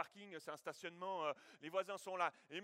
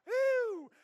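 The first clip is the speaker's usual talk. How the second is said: mock whoop; 15 dB louder than usual. Car drops off the jack.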